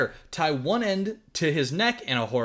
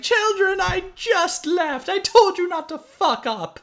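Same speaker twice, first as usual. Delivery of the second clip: much higher, louder scolding nanny; exasperated Truly; 5 dB louder, slower than usual. Retold or imitated. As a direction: tell the kids off in a shrieking huff. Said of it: "it drives past Truly, and it's like".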